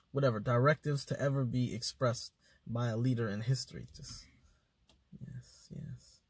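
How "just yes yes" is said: soft unvoiced whisper of yes, yes. Shown as a demonstration. To show smiling and nodding, a whispered yes, yes. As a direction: breathe the yeses like a nodding yes-man. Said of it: "smile and nod demo".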